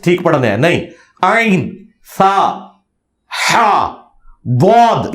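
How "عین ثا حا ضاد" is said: "عین، سا، ہا، بود" as drawn-out Arabic letters, each from its proper place; Arabic articulation. Shown as a demonstration. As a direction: sound out "عین، سا، ہا، بود" slowly, one isolated Arabic letter at a time. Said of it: "یہ صحیح نکلنا چاہیے". ("عین، سا، ہا، بود" کو ٹھہر ٹھہر کر درست عربی مخرج سے ادا کریں)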